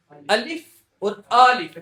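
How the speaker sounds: two الف said loudly and slowly; contrasting alifs. Shown as a demonstration. Teacher contrasting two sounds loudly. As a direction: hit both الف to show the difference. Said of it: "دیکھو فرق کتنا ہے".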